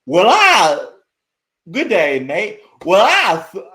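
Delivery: loud, high, hammed-up Aussie accent that falls apart; botched Australian accent. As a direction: push a clumsy Australian accent, good day mate. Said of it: "I can't fucking do it".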